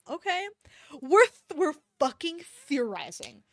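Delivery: loud, high burst of excitement; excited outburst. Real, not acted. Reader getting carried away with a theory. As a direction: jump in, thrilled at the idea.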